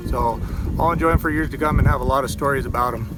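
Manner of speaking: normal talking voice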